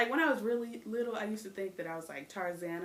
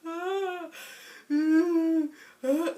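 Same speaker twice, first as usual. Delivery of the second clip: much higher, louder hammed-up crying; dramatic kid wail; 8 semitones higher, 9 dB louder, much slower than usual. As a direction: wail like a child denied her song. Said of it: "I'd be like".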